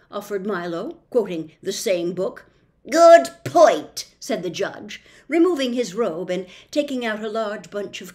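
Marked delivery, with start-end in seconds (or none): 2.9-4.1 loud, slowish officious policeman; pompous policeman. Acted. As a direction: lay down the law with bluster. Judge agrees.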